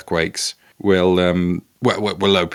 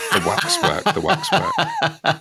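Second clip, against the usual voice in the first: breaking up on the words; laughing; 9 semitones higher than usual. Real, not acted. Host clarifies Crippen's head was wax, laughing. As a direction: try to clarify through laughter.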